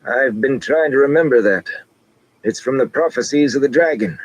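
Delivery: pleased with himself; old man explaining. A narrator's reading. Noel explains after reciting.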